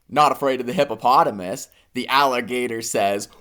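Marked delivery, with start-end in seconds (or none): none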